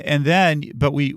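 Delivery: ordinary delivery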